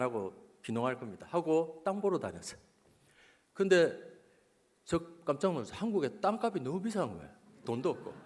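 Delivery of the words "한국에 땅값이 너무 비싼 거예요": ordinary delivery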